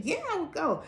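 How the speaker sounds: a mother jumping at the trip; a hint of an excited mother; eager mom. Mom agreeing again.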